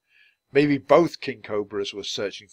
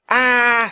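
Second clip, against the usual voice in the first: startled scream; 12 semitones higher, 10 dB louder than usual. A narrator's reading. Giant cobra bares its fangs.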